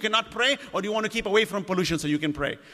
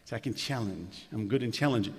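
mock boasting; 11 semitones lower, 9 dB quieter than usual. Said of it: "to prove to his friends".

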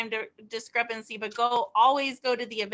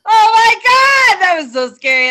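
shouting; 10 semitones higher, 15 dB louder than usual. Real, not acted. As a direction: shout it out, thrilled and scared. Reacting to scary poem.

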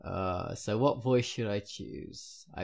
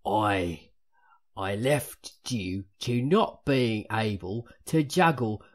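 loud, slow Cockney in a Michael Caine manner; hammed-up Cockney, louder and deliberate; Cockney accent; 6 dB louder, slower than usual. Shown as a demonstration. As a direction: put on a drawn-out, emphatic Cockney. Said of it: "Cockney is what it is".

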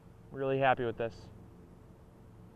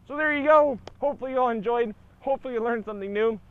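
chewing while talking; mouth full; 9 semitones higher, 7 dB louder, faster than usual. Real, not acted. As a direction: talk through a mouthful of honeycomb. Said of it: "my mom always taught me not to talk with my mouth full".

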